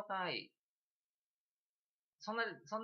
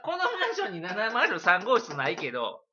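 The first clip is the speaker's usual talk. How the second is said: loud, slow question through a laugh; laughing mock question; 6 semitones higher, 9 dB louder, slower than usual. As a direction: ask while trying not to laugh. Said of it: "って聞かれたら". (笑いをこらえながら大きくゆっくり問う)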